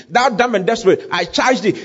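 high, ringing exorcism command; preacher acting out a commanding rebuke; stern rebuke. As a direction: order the spirit out with authority. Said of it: "He rebuked the foul spirit".

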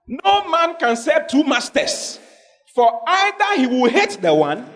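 slow, high proclamation; weighty scripture read aloud; solemn scripture reading. Real, not acted. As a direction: read the verse slowly and weightily. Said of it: "preacher quotes scripture".

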